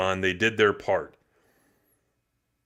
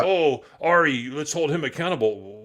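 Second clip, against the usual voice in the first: mocking quote; 6 semitones higher, 5 dB louder than usual. Mocking a naive opinion.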